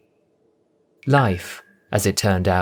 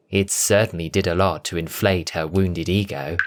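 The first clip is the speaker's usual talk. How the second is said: ordinary delivery, nothing marked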